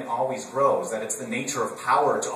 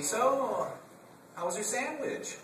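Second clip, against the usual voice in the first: server voice; 12 semitones higher, slower than usual. Retold or imitated. Server asks about his meal.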